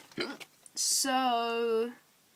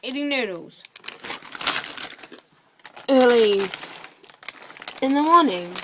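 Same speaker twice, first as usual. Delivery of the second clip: louder, higher tipsy rant; loud and worked up; 4 semitones higher, 7 dB louder than usual. Real, not acted. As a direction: build up into a loud ramble. Loud high rant to herself.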